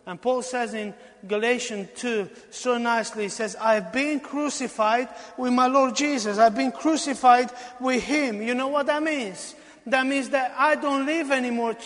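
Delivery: high, loud, fervent preaching, slowing at the peak; preaching at full force. Acted. Preacher proclaiming Galatians two, high and fervent. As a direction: cry it out in full fervour.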